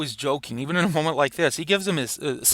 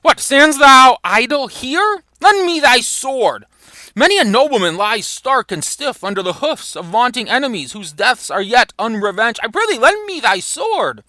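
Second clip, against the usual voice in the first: high, urgent theatrical scolding; acted reproach rising to a pleading demand; chiding; 9 semitones higher, 4 dB louder than usual. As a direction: demand it impatiently as a prince in battle. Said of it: "he chides Falstaff".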